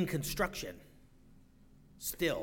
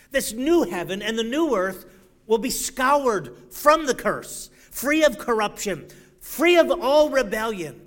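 preaching; 5 semitones higher, 7 dB louder than usual. Acted. Preaching at full force.

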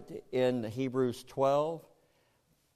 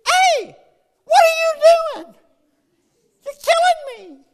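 very high, loud squealing protest; talking tree in pain; 13 dB louder, slower than usual. Pretend. Fruit tree to the farmer pruning it, very high loud squeal. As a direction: shriek like a fruit tree being cut. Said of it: "And the tree's going".

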